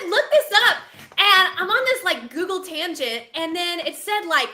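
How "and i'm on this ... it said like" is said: ordinary talk, nothing marked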